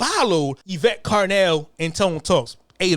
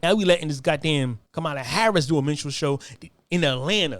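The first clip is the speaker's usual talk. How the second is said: normal talking voice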